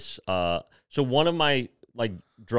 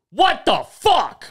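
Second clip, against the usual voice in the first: high, loud, slow angry yell; boss yelling; 7 dB louder, much slower than usual. Retold or imitated. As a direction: bellow it like a furious boss. Boss yells from his office.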